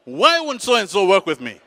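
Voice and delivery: loud, high demand for an answer; acted indignant shout; agitated outburst. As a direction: burst in demanding to know why. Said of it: "burst in there and says".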